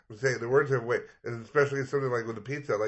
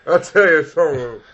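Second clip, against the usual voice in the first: loud, higher-pitched comic impression; Bernie Mac; 6 semitones higher, 10 dB louder than usual. Riffing on his own impression, Bernie Mac voice. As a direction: belt it out like a hyped stand-up comic. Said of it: "my Bernie Mac impression".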